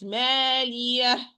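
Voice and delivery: "مالية" stretched and closed with an audible h; ha ending. Shown as a demonstration. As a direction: finish "مالية" on a breath of h.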